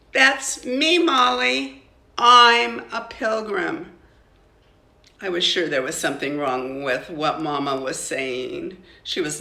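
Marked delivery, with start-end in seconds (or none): 0.1-3.9 higher, louder, proud declaration; mother tells daughter she's a pilgrim, proudly. A narrator's reading. Proud, louder declaration.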